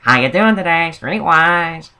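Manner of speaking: high, sing-out imitation of a newspaper seller; annoying street vendor. Imitating the lady selling Streetwise outside Wendy's, annoying hawker voice. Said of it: "her voice is like this".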